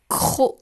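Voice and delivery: "croc." hard and loud; dictated syllable. Shown as a demonstration. Teacher dictates the last syllable to pupils, loudly articulated. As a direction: punch out "croc." with a forceful opening sound. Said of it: "Je répète".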